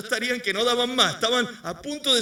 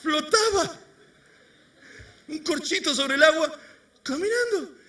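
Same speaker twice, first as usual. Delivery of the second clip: much higher, delighted; playful high telling; 8 semitones higher than usual. A narrator's reading. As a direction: bob up light as a cork. Playful cork image.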